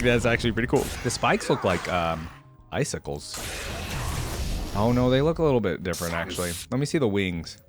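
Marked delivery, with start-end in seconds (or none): none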